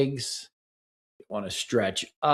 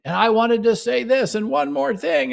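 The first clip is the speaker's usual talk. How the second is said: higher, mock-agitated rush of grievance; mock heated rant; 11 semitones higher, 3 dB louder than usual. Therapist imitating a flooded partner's thoughts, high mock-heated rant. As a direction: blurt it like you can't let go. Voicing racing angry thoughts.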